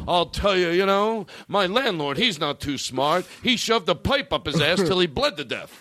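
high, punchy put-on stand-up comic; Rodney. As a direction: gripe about the family like an old-school joke teller. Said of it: "It's the guy who's Rodney".

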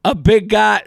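ordinary delivery

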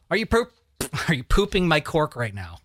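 breaking into a laugh mid-line; giggling while reading; real laughter cutting in; laughing through it. Real, not acted.

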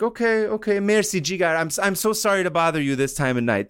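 high-pitched, apologetic caller easing down; general on the phone. Retold or imitated. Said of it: "And Yusufi is like".

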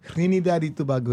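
"Trinidad y Tobago" with Spanish vowels; Spanish-style pronunciation. Shown as a demonstration. Guest confirming the correct name, Spanish-style "Trinidad y Tobago". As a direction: pronounce "Trinidad y Tobago" tree-nee-DAD ee too-BAH-go. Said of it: "is correct".

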